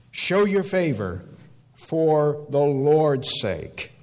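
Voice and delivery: slow, stretched-out entreaty; drawn-out prayerful appeal; crying out in prayer. Retold or imitated. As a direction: plead it one weighty word at a time. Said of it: "he cries out to him".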